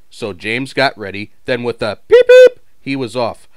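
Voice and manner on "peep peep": very high, loud whistle toot; piercing train whistle call; Edward's cheerful whistle; high whistle peep. A narrator's reading. Engine whistle.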